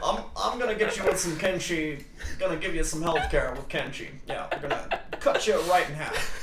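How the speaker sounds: mock politician making promises; Barack Obama. Streamer as a president playing Mortal Kombat, Barack Obama impression. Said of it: "You're doing a good Barack Obama earlier".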